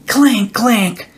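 high, bright imitated clank; playful clanking noise; clanking cans. A narrator's reading. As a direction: make the tin-can clatter with your voice. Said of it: "The sound of clanking cans".

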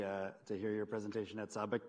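ordinary delivery

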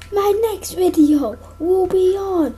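ordinary talk

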